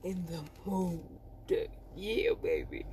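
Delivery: low, soft, jokey come-on; mock sultry. Joking about being in the mood, mock sultry. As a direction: purr it softly as a joke. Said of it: "I'm not talking, like".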